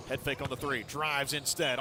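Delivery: usual speaking voice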